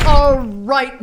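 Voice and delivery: loud command. Acted. Wife storming the theater, loud command. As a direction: burst in and take charge.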